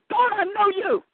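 high, insistent challenge; very stern. Retold or imitated. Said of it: "I said it very stern".

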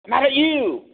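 high hammed-up angry cry; mock mad outburst. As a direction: play a cartoonishly mad God. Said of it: "we picture someone who says".

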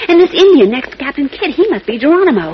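thrilled to recognise him; eager museum explorer; excited discovery. Acted. Lois excited among wax figures.

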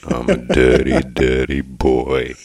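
low, drawn-out Australian catchphrase; catchphrase on request. Shown as a demonstration. Co-host repeating his catchphrase for the show, low and drawn out. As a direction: drop it low and stretch out the last word. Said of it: "You want that again this week?".

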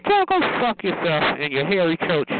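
ordinary talk

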